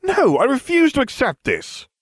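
very high, loud protest; shrieky outraged objection; indignant refusal. A narrator's reading. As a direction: refuse it outright, scandalized. Student's outburst against headmaster.

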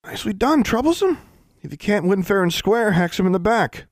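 mock her with scorn; sneering boy. A narrator's reading. Narrator voices sneering Larry.

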